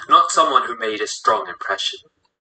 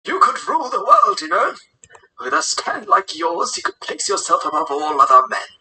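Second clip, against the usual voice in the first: raised, strained character voice; urging old leader; 6 semitones higher than usual. Acted. Old leader urges Massimo to see his power, raised voice. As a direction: urge him on with pitch lifted high.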